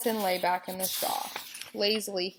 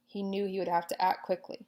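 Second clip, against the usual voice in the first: usual speaking voice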